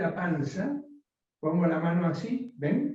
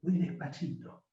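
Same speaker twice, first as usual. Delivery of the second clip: softer, slowed right down; low, very slow; 8 dB quieter than usual. Shown as a demonstration. Coach showing the class a low tone, soft and slow. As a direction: drop the voice and go very slowly. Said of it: "Si yo hablara como tono bajo".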